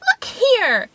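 high, piping retort; little man crying out. A narrator's reading. Little man retorting to the wolf, high and defiant. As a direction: answer back in a tiny high voice. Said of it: "cried the little man".

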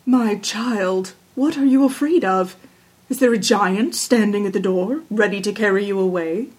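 lightly voiced, measured father king; a hint of a kingly father; concerned king. A narrator's reading. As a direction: question the child with fatherly concern.

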